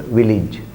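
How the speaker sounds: village said as VIL-ij; correct pronunciation. Shown as a demonstration. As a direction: say village with a short "ij" ending. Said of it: "This is the correct answer".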